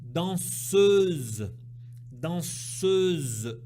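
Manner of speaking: Danseuse with a long, loud eu; stressing the E. Shown as a demonstration. Teacher drilling the eu of danseuse aloud. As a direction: stretch the eu of Danseuse. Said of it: "bien insister sur le E".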